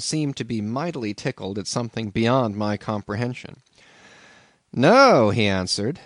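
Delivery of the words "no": a lightly raised, chuckling innkeeper; landlord's voice. A narrator's reading. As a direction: play the amused old landlord. Landlord answers Ishmael.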